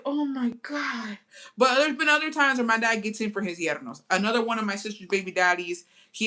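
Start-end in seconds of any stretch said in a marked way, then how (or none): 0.0-1.2 slow, drawn-out disbelief; stunned. Retold or imitated. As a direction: stretch it out, hushed shock. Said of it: "I said".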